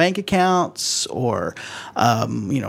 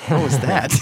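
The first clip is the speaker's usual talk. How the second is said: laughing. Real, not acted. Laughing at caller's burp.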